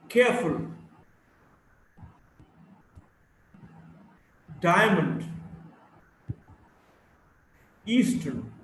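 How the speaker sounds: each word, Backward to Yellow, hit on its first syllable then falling; first-syllable stress. Shown as a demonstration. Teacher modelling two-syllable words, each stressed on the first syllable. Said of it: "stress is on the first syllable".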